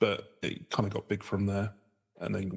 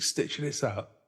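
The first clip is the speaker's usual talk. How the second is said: mock Cockney accent; 5 semitones higher, slower than usual.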